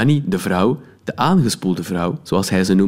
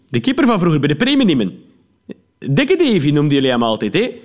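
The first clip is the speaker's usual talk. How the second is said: chummy cop; 7 semitones higher than usual. Acted. Policeman recalls his son the goalkeeper, chummy. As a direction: reminisce eagerly.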